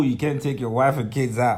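ordinary delivery

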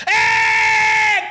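high shouted cheer; a delighted crowd's whoop. Retold or imitated. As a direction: yell like a happy crowd.

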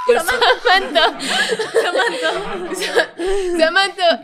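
stammering his name, laughing and panicked; nervous laughing. Retold or imitated. Panicking at the door when the boy confesses, laughing.

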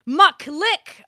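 hammed-up ecstatic read of filthy letter; gleeful reading. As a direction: savour each "muck" like a treat.

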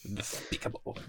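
breathy death-metal whisper. Pretend. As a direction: whisper it menacingly. Echoing the song title.

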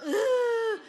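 high loud whimpering cry; high strained mock whimper. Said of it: "especially when I go".

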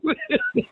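high-pitched burst of giggling; squealing laugh; high giggle. Real, not acted. Laughing together.

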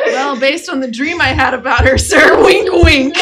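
loud, laughing sly reply; laughing cheeky. Acted. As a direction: tease the father with a wink.

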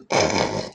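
mock throat-clearing hawk and spit; noisy mimed hawk; hawking up phlegm. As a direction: imitate coughing up a gob of phlegm. Teller mimes spitting phlegm into it.

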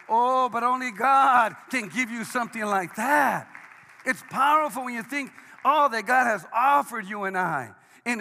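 loud, driving sermon delivery; fiery preaching. Acted. Pastor rousing the church, loud preaching. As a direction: preach it full force to the congregation.

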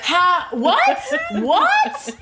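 high, laughing "what"; laughing incredulously about a coworker's message; laughing disbelief. Real, not acted. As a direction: crack up in disbelief. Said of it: "It was so funny".

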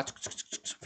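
unvoiced clicking sounds; typing noise. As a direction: click tongue like typing keys. Imitating typing on laptop.

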